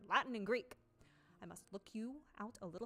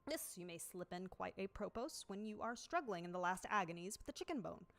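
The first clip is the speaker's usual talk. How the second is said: ordinary delivery, nothing marked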